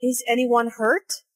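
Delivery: worried question, rising on "hurt"; rising question intonation. Shown as a demonstration. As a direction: pitch rises at the end on "hurt". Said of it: "so the intonation rises at the end".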